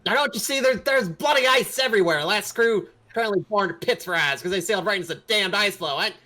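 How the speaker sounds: high, loud rant easing off by the end; shouted gruff grumbling that settles down; dwarf yelling. Acted. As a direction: yell in exasperation, then drop to a dismissive close. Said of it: "is yelling at you".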